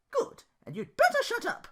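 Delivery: high loud snap; angry snap. Acted. Belle snapping at Duke to shut up, high and loud. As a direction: cut him off sharply. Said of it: "Belle snapped immediately".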